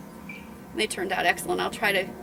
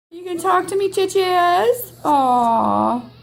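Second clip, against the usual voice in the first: much higher and louder, fond cooing to the dog; cooing pet talk; 9 semitones higher, 7 dB louder, slower than usual. Real, not acted. Owner coos at crying dog.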